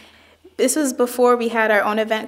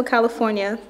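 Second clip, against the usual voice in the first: ordinary talk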